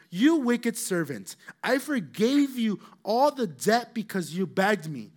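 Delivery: slow, loud, high reproach; the master scolds the wicked servant, stern and slow; stern rebuke. Acted. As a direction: rebuke him slowly and gravely.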